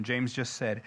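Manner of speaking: normal talking voice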